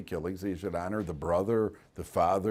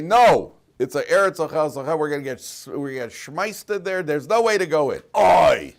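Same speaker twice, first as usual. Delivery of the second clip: loud, fearful protest; loud alarmed spies; 6 semitones higher, 9 dB louder than usual. Retold or imitated. Spies loudly warning the people against the land. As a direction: shout the danger to scare them off. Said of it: "the Meraglim say".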